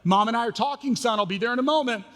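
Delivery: calling back to a son in the next room. Retold or imitated. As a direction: call back to the other room.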